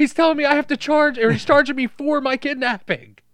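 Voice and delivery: high, loud mock panic; mock panicked yell. Victim yelling about paying the cab fare.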